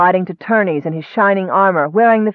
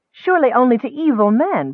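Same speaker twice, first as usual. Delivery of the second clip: higher, indignant protest; 8 semitones higher than usual. A narrator's reading. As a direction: push back, incredulous. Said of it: "protested Nicole".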